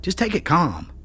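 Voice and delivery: calm the angry friend down, gently pleading; friendly storekeeper, lightly voiced. A narrator's reading. Phil's character voice.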